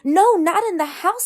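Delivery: high, loud fish cry of alarm; the fish shouts no in alarm. A narrator's reading. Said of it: "said the fish in the pot".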